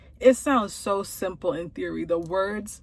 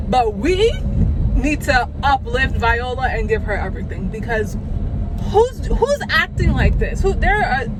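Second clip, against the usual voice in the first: louder, higher, gushing praise; excited raving; 4 semitones higher, 8 dB louder than usual. Real, not acted. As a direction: rave about her like a fan. Raving about Viola Davis' acting.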